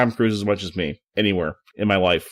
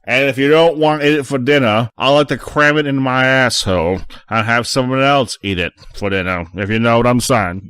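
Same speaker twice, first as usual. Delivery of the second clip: louder, mock Southern country accent; 5 dB louder than usual. Joking on the podcast in a hammed-up country accent. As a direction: ham it up like a Southern ad voice. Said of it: "That is your best country accent".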